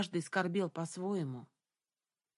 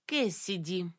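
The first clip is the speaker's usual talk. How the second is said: plain reading voice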